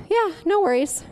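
breezy, unbothered reply; casual reassurance. Acted. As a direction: play the unconcerned husband. Husband's casual reply, against her panic.

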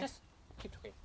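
whispered aside; whispering. Real, not acted. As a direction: whisper it so others keep going. Aside while someone else talks.

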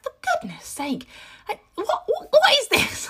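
high-pitched baffled protest; exasperated parent. Retold or imitated.

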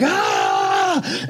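high furious shout; driver's wordless rage scream. Retold or imitated. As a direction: burst out in a raging yell. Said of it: "I'll be like".

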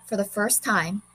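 ordinary delivery, nothing marked

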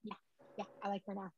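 barely audible grudging yeah; mumbled. Retold or imitated. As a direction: mutter it reluctantly. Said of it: "I was like".

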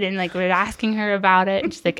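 ordinary talk

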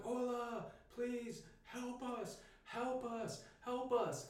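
high, slow begging cry without much volume; retold pleading. Fleeing campers to passing horsemen, retold pleading. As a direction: call out desperately to distant strangers, re-enacted.

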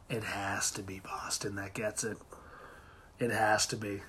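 hushed whisper. Real, not acted. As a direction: breathe it out in a whisper. Whispered gloat.

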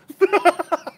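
squealing laugh; high burst of laughter. Real, not acted. Laughing fit. (éclat de rire aigu)

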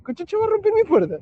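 high, laughing outrage; half-laughing indignant rant; mock exasperation. Brother fumes at a broken door, laughing outrage. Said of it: "me cagué de risa y de cólera".

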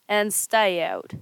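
dragged-out dismissal; slow final order. Acted. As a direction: draw it out slowly. Ogre dismisses villagers.

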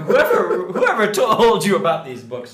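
delighted laughing thanks. Real, not acted. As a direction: laugh it out, amazed. Blown away by the books.

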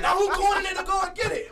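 high loud laughing yell; high laughing mock yell; retold shout. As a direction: shout it while cracking up. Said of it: "I said".

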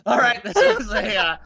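high laughing protest. Real, not acted. Host laughing at cohost's swearing, high laughing. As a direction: laugh through the scolding.

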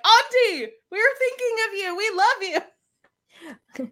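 high, sing-out greeting, stretched at the end; gushing call-out. Pretend. Imagined TikTok shout-out to Auntie, high gushing call. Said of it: "and be like".

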